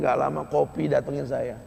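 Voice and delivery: normal talking voice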